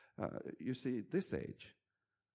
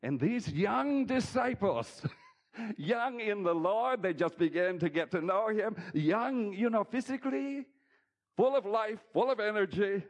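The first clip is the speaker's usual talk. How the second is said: preaching; 11 semitones higher, 8 dB louder than usual. Real, not acted. Pastor preaching on young disciples, full force. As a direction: lift it up and preach it out.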